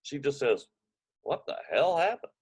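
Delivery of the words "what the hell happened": startled question. Retold or imitated. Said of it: "she doesn't mean a thing by it".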